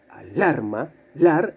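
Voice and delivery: "alarma" with LAR as the strong syllable; "alarma" leaning on the middle LAR, then "lar" alone; middle-syllable stress. Shown as a demonstration. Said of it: "la sílaba fuerte".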